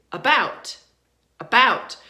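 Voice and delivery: "about" with a weak uh and a strong BOUT; stress on second syllable. Shown as a demonstration. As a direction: punch the second half of "about". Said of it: "We stress the second syllable".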